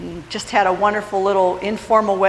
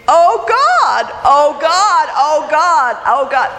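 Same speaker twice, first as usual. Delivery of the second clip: high, loud cries easing off; retold screaming; 7 semitones higher, 5 dB louder, slower than usual. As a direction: re-enact a panicked rider's cries. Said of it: "I started screaming".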